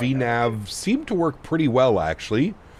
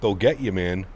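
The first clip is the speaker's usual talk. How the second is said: normal talking voice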